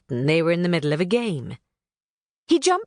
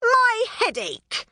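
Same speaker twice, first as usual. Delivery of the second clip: much higher, slow drawn-out mock scream; screaming pixie; slower than usual. Knotgrass fleeing the rain, high slow mock scream. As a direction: wail it out dramatically. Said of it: "Knotgrass screamed".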